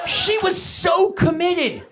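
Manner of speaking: high, drawn-out outrage; hammed-up high exasperation; exasperated rant. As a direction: stretch it out in comic disbelief. Father ranting about being fooled.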